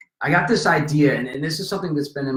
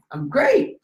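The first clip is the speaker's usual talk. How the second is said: retold excitement; 12 semitones higher than usual. His retold excited question.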